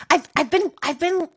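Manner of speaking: ordinary talk, nothing marked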